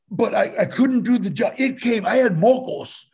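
normal talking voice

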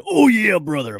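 lightly done wrestler growl; a hint of Macho Man hype. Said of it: "the Macho Man".

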